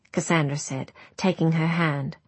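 ordinary delivery, nothing marked

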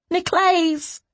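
very high, stretched cry. A narrator's reading. Captive to his friend in the dark, crying out. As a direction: cry the name out from afar. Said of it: "crying out".

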